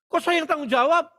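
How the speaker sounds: high, protesting question; mock indignant. Blamed preacher answers the mother, high mock-indignant voice. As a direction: toss the blame back in comic disbelief.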